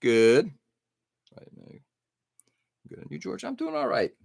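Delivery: usual speaking voice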